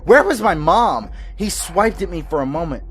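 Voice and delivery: frantic. A narrator's reading. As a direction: build the terror as you tell it. Frantic escape builds in intensity.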